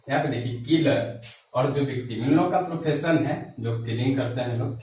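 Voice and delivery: ordinary talk, nothing marked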